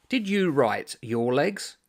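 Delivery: slow questioning retort. Acted. The author turning the question back on the Irishman, slow. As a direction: drag the question out pointedly.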